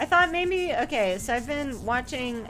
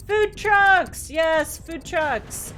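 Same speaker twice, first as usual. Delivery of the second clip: excited; 5 semitones higher, 6 dB louder, much slower than usual. Real, not acted. Excited exclamation about food trucks.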